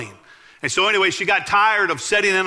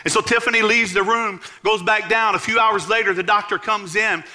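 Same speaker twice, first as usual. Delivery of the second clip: rising, raised sermon voice, building to the healing; fiery preaching; 4 semitones higher than usual. Acted. Preacher telling the congregation of Tiffany's healing, fervent and raised. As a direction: preach it out with growing fire.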